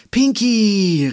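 high, loud squeal of a name; delighted cry. A narrator's reading. Girl finding her beloved backpack, delighted cry. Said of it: "rief sie begeistert".

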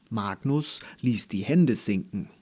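usual speaking voice

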